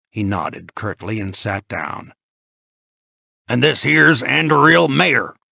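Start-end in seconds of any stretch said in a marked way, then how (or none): 3.5-5.4 loud, high, hearty introduction; folksy host with no foreign polish; plain-spoken Ben. A narrator's reading.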